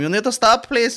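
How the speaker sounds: high shouted plea to stop; mock staff shout. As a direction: call out high after the runner. Staff shouting at fare dodger.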